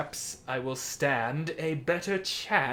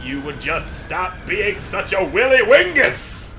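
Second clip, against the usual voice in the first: mock insult yell; 6 semitones higher, 8 dB louder than usual. Yelling at boss.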